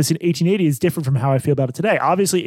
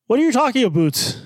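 Canadian accent; 7 semitones higher than usual. Shown as a demonstration. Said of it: "Can you do one first so I can".

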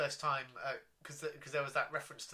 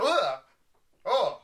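loud shocked ugh and oh; disgusted groan; 7 semitones higher, 11 dB louder than usual. Retold or imitated. Recoiling at the swearing.

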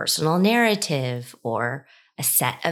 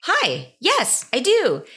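eager yes; 9 semitones higher, slower than usual. Retold or imitated. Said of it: "And I'm like".

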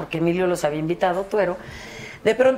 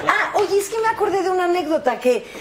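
laughing through the words, much higher; giggling as the memory hits; 10 semitones higher than usual. Real, not acted. Guest recalling an anecdote, laughing while starting it. Remembers a funny story.

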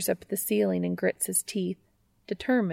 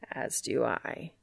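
lower, slow gravel-free rumble; deep gruff Castiel voice; 7 semitones lower, 6 dB quieter than usual. Acted. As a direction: drop the voice low and slow. Castiel's voice in story.

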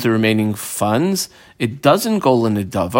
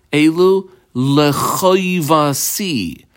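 lechoivasi drawn out slowly and raised in pitch; stress on lechoivasi; 4 semitones higher, much slower than usual. Acted. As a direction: quote it slowly, leaning hard on "lechoivasi". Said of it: "the emphasis on the word chovasi".

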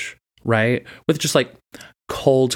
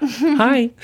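squeaky hi; 13 semitones higher, 3 dB louder than usual. Pretend. Squeaky high greeting.

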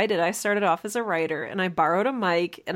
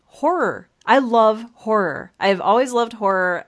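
louder, relish each word about horror; excited enthusiasm; 3 semitones higher, 6 dB louder than usual. Real, not acted. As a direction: gush about loving horror.

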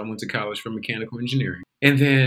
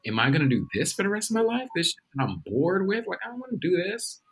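higher-pitched incredulous complaint, acting it out; acted-out exasperation; 6 semitones higher than usual. Bored engineering student questioning his life, mock exasperated. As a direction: replay the fed-up question to yourself, voice climbing.

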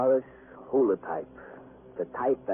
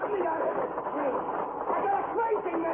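panicked scream; much faster than usual. Acted. Prisoner trapped with a detective, panicked scream. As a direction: scream for rescue at the top of your range. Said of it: "he screamed again".

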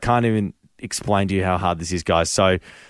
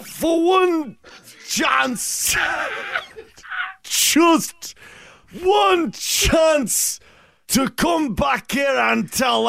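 hammed-up Scottish William Wallace battle speech, slow and high; rally the troops in an overdone Scottish brogue; mock Scottish accent; 13 semitones higher, much slower than usual. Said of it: "you've gotta do it with the accent as well".

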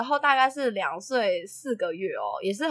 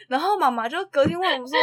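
ordinary talk

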